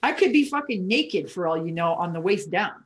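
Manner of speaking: ordinary talk, nothing marked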